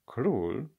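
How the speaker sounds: plain reading voice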